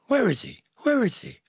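high-pitched, repeated urgent question; urgent bewilderment. A narrator's reading. Joseph pressing Mary to explain, urgent and high. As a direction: jump up in pitch on each "Where".

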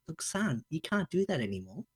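hushed fatherly warning; quiet serious talk to a son; a hint of a stern father, soft; mock stern and quiet. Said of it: "I'm like".

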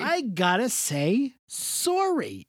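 drawn-out Canadian "sorry", slow; lightly mocking Canadian delivery. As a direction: slowly drag out "sorry" the Canadian way. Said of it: "you were like".

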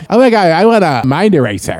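put-on high, loud college girl gush; mimicking Sue. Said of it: "Stop mimicking me".

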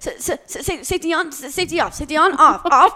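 hammed-up flustered stutter, rising to a laughing yell; mock panic. Retelling mom's panic at the gun, mock-flustered stammer. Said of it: "She's like".

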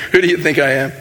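ordinary delivery

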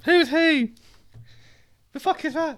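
high squeaky little character. Acted. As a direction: pitch way up and squeak.

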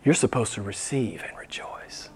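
breathy hushed whisper; whispered reminder. Acted. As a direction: whisper it close to them.

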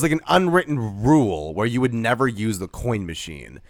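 ordinary talk, nothing marked

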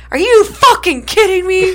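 imitated outraged yell, high and drawn out; MJF. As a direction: yell in mock disbelief, slow and loud. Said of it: "And he's like".

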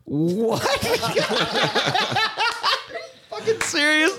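high, laughing disbelief; can't believe what you heard; shocked disbelief. Real, not acted. Learns bois means wood.

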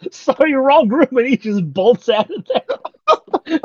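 laughing through the quote; real laughter in the words. Real, not acted. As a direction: giggle it out up high. Laughing while quoting kid.

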